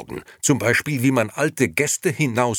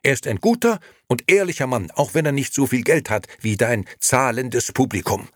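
high, forceful rebuke; loud indignation; 9 semitones higher, 6 dB louder than usual. A narrator's reading. Liliana defends her uncle to Nino, loudly indignant. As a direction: scold him at full voice. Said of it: "rief sie und stemmte die Arme".

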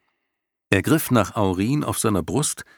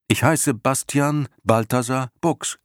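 higher, lightly voiced young boy; 7 semitones higher than usual. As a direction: answer boldly as a young boy. Bastian answers the lion.

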